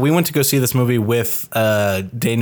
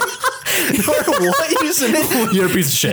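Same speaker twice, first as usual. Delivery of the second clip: laughing; 13 semitones higher than usual. Real, not acted. Joking insult, laughing.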